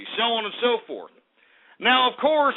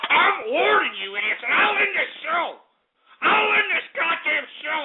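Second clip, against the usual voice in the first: angry threat; 8 semitones higher than usual. Acted. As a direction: threaten them in a high, raging voice. Host threatens to end show.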